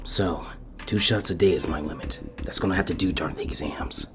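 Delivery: soft, low self-talk; muttering to himself. A narrator's reading. Sasuke alone after training, quietly to himself. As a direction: talk it over quietly to yourself. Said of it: "he said to himself".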